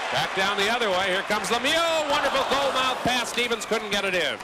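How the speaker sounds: high-pitched excited hockey call; excited play-by-play. Real, not acted. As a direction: call the rush as it happens. Excited play-by-play of scoring chance.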